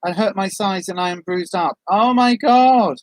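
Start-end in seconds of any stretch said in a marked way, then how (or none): 1.9-3.0 shocked. Real, not acted. Reacts to fall.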